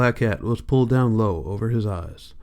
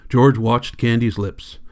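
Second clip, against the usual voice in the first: ordinary delivery, nothing marked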